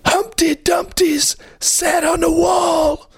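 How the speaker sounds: very high, shouted nursery rhyme; high-pitched poem. Pretend. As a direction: recite it in a squeaky cartoon voice. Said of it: "doing Humpty Dumpty poem".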